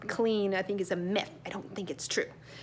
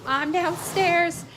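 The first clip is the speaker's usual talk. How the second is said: loud, higher call to another room; yelling across the room; 8 semitones higher, 5 dB louder, much slower than usual. Real, not acted. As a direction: call it out slowly so he hears downstairs. Answers child across house.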